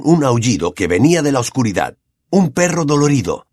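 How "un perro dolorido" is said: usual speaking voice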